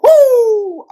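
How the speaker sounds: high, loud excited whoop; excited woo. Real, not acted. Preacher fired up mid sermon, loud excited whoop. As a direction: let out a joyful shout.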